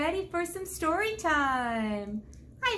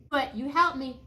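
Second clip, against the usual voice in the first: ordinary delivery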